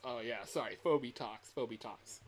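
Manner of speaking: ordinary talk, nothing marked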